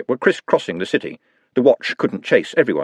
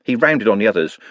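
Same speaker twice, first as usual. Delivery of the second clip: ordinary delivery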